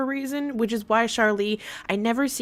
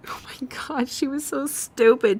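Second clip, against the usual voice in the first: whisper; 4 semitones higher than usual. Real, not acted. Gossip aside, whispered.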